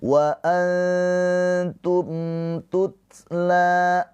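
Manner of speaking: tutla with the la held two beats; la dibaca panjang dua harakat; long la. Shown as a demonstration. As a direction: stretch the la of tutla. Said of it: "La panjang dua harokat ini".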